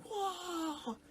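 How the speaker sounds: a hammed-up crowd gasp of shock; mock awe. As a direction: crowd voiced in a silly high whoa. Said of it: "It was like".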